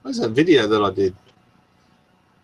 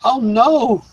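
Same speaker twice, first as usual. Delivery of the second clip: high, loud sudden oh no; dismay, much higher and louder; real dismayed oh no; 13 semitones higher, 4 dB louder than usual. Real, not acted. Streamer can't find the Tesla footage, high dismayed cry.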